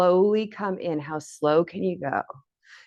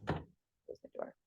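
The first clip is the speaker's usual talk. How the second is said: whispered aside, off to the side; hushed aside; 18 dB quieter, slower than usual. Real, not acted. Instructor whispering to someone nearby to close the door. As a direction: breathe it out as a quick private aside.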